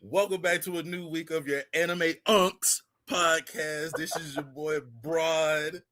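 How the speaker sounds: second take of the show opener, enunciated; podcast intro take two. Shown as a demonstration. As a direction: announce it clearly like a radio host. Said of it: "All right, take two".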